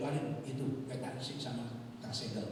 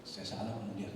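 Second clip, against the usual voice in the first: ordinary talk, nothing marked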